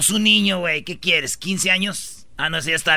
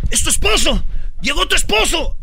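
high, loud panicked warning; alarmed cry; 9 semitones higher, 5 dB louder than usual. Acted. Man telling woman her husband arrived, alarmed cry.

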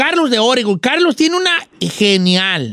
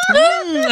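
high laughing mmm over the bone; a greedy, playful mmm; hammed-up yum hum; mock savoring hum.

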